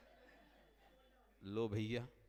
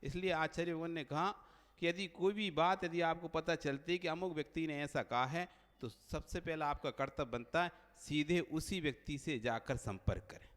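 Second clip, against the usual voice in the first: ordinary delivery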